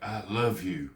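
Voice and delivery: soft, heartfelt declaration; tender and sincere, dropping in volume. Shown as a demonstration. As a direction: say it softly, like you mean it. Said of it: "it sounds like I mean it".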